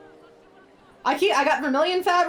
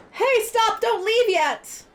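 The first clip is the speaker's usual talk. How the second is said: calling out; 8 semitones higher, slower than usual. Acted. Character calling after departing friend.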